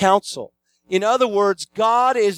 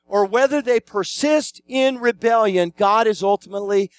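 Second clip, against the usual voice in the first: ordinary delivery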